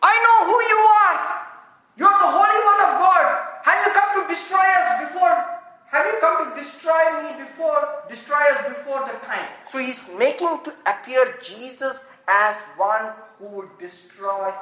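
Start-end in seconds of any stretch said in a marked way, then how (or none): 0.0-9.5 loud, high, fast mock demon crying out; taunting demon. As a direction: shout it like a demon taunting Jesus before the crowd. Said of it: "So he says".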